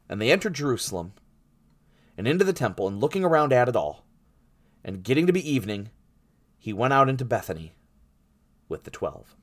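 emphatic, deliberate Bible reading; scripture reading. A narrator's reading. Preacher reading his own Mark translation to the congregation, emphatic scripture reading. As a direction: read the Gospel out to a congregation, stressing key words. Reads the scripture passage aloud.

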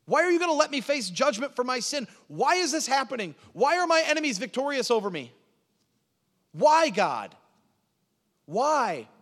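high, loud cry to God; anguished prayer shouted upward; desperate pleading. Acted. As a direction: plead urgently, pitched far up, question after question. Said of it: "he is pleading with God".

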